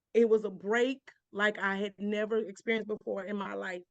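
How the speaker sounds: ordinary talk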